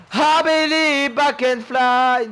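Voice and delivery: high, drawn-out holler; loud nonsense cry; belted outburst. Real, not acted. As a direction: belt it out, stretching the end. Singsong outburst, then says sorry.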